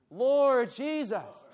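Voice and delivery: slow earnest cry of Lord Jesus; slow fervent call. Retold or imitated. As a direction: stretch each word out, pleading. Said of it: "That was his response".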